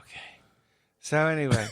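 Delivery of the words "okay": whispering. Real, not acted. Playful whispered exchange.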